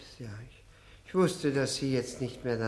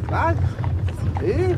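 startled shout; 13 semitones higher, 8 dB louder than usual. Acted. Startled drunk.

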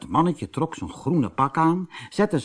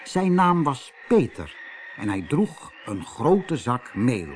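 usual speaking voice

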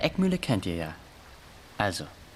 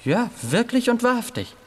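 much higher and louder, earnest vow; eager insistence; 11 semitones higher, 4 dB louder than usual. Acted. Scared boy insists to the bully it is true. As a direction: swear it's true, too eagerly.

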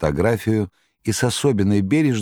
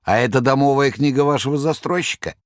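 much higher jester voice; Koroviev; 9 semitones higher than usual. Acted. Koroviev reassuring the master, high clownish voice. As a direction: jeer like a clownish trickster.

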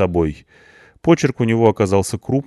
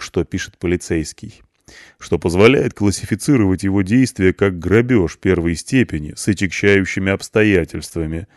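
ordinary delivery

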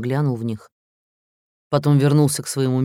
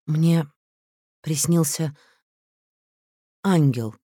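trembling voice; 3 semitones higher, slower than usual. Acted. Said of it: "произнесла она дрожащим голосом".

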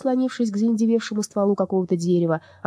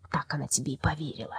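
doubt it under your breath; sceptical mutter; 2 semitones lower, 8 dB quieter than usual. Acted. Sceptical reply, low.

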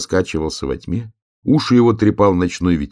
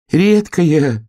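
higher, louder hare voice; high, emphatic hare quip; hare's unspoken retort; 10 semitones higher, 6 dB louder than usual. A narrator's reading. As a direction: retort in a raised little voice. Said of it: "хотел сказать заяц".

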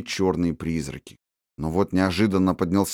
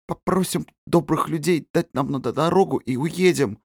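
barely moving tongue; 7 semitones higher than usual. Acted. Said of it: "еле ворочая языком".

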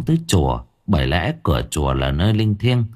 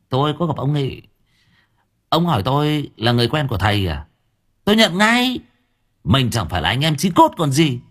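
chatty driver voice; 6 semitones higher than usual. Acted.

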